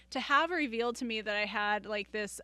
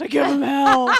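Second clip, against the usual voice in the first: loud booming coach call; track coach voice; 3 semitones higher, 15 dB louder than usual. Acted. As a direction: bellow it from the sidelines like a track coach. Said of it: "I feel like it's like track coach".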